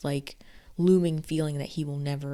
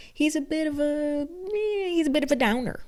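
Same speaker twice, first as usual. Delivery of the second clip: much higher, mocking sing-song; 12 semitones higher, 5 dB louder than usual. Reviewer mocking book Louis as a downer, high mock voice.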